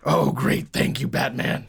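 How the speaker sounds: voiceless mock-vigilante rasp; whispery, husky Batman impression. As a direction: rasp it out like a parody superhero. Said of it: "we should all do Batman voices".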